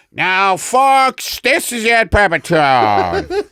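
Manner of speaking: loud, high breaking-news announcer. Pretend. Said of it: "you hear the announcer".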